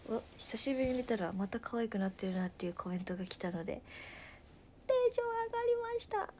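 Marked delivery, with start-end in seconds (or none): 4.9-6.3 high-pitched burst of excitement; excited. Real, not acted. (テンション高めの高い声)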